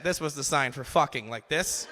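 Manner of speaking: ordinary delivery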